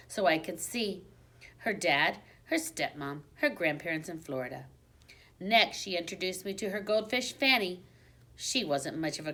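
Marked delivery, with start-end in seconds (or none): none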